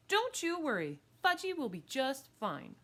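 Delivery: put-on high, loud girl's promise; eager reassurance. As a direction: reassure her, overconfident. Girl eagerly reassures the mother.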